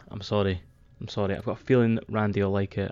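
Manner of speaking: ordinary talk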